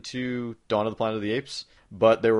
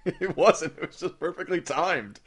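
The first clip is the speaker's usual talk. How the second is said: laughing; 7 semitones higher, slower than usual. Real, not acted. Laughs after the drink spit.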